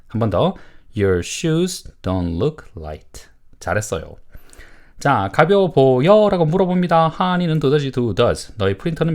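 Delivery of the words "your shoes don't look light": slow, word-by-word model statement; model reading. Shown as a demonstration. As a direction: read slowly for learners to repeat. Teacher models answer for repetition. (천천히 또박또박 읽는 시범)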